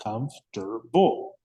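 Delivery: "Comfortable" slowly as kumf, ter, bul; broken into syllables. Shown as a demonstration. Teacher confirming three syllables, "Comfortable" slowly beat by beat. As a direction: count out the three beats of "Comfortable". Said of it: "We have three syllables".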